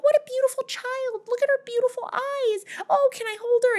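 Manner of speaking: very high falsetto gushing; hammed-up high cooing; cooing court ladies. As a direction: gush over a baby in a mock feminine falsetto. Court women cooing over baby.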